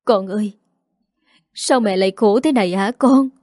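halting, with long pauses before each phrase; hesitant. A narrator's reading. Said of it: "ngập ngừng lên tiếng". (ngập ngừng, ngắt quãng lâu)

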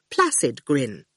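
ordinary delivery, nothing marked

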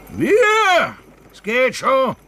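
high, loud shout that settles into coaxing; urging the horses. Acted. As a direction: drive the tired team on with your voice. Driver urging stuck horses.

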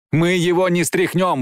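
high, despairing cry; desperate exclamation. Acted. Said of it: "воскликнула Джейн в отчаянии".

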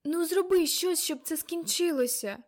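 high, loud plaintive plea; plaintive whimpering. A narrator's reading. Little Karolina begging for it to end, plaintive whimpering. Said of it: "Кароліна жалібно запхинькала".

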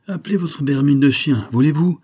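loud, angry yell at the stranger. A narrator's reading. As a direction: shout it, fresh from sleep. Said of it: "hurla le voyageur réveillé".

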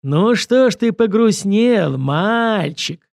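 high, loud, drawn-out mocking question; mock-sympathetic taunt, lingering on the words; predatory taunt. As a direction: stretch it out, toying with prey. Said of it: "хищно ощерился режиссёр".

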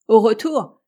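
sharp, loud incredulous cry; captain scoffing at talk of returning home, loud; exclaiming. Acted. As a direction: fling the words back at him. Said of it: "S'écria Hatteras".

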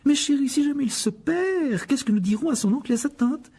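a brisk mother of many sons; Mrs Weasley. Acted. Molly worried. (voix de mère plus aiguë)

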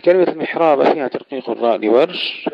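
normal talking voice

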